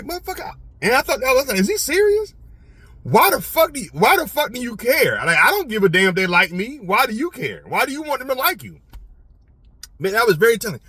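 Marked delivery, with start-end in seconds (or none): 0.0-8.8 high-pitched, incredulous comeback; indignant retort. Retold or imitated. Security guard challenging his manager's question, high-pitched indignant retort. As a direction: fire back in disbelief, voice shooting up.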